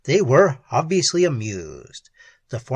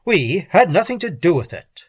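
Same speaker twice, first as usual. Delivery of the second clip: raised, alarmed denial; rattled, louder and higher; flustered protest; 4 semitones higher, 5 dB louder than usual. A narrator's reading. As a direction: protest innocence with composure gone. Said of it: "losing his self-possession utterly".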